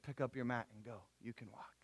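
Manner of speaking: low, soft words fading to a whisper; gentle hush. Acted. Jesus telling the paralytic to walk, gentle hush. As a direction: command softly, almost whispering by the end.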